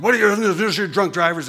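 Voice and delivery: retold indignant objection; upset critic. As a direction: fire it back like an offended critic. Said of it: "someone got upset one time and said".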